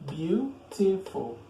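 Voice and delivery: BEAUTIFUL slowly split into three syllables. Shown as a demonstration. Teacher counting three syllables in a long adjective, syllable by syllable. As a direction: say Beautiful in three drawn-out beats. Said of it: "As a three syllables".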